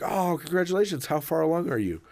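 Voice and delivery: a hint of eager courtesy; cheerful salesman. Salesman greeting a customer, lightly chipper. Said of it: "And I was like".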